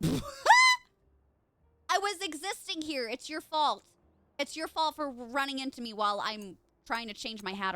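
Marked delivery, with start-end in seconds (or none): 0.0-3.1 slow, high, loud laughing complaint; laughing mock protest. 3.1-3.8 louder, higher teasing it's your fault; mock blame.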